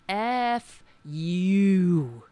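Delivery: very slow, stretched-out rebuke; indignant, drawn out. Real, not acted. Host cursing a billionaire candidate, indignant and drawn out. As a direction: draw each word right out in disgust.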